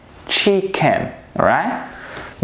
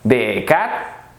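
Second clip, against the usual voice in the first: ordinary talk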